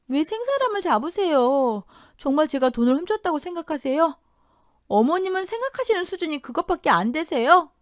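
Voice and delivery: indignant, high-pitched pushback; indignant protest. Acted. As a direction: protest the accusation without shouting. Daughter-in-law protests false accusation.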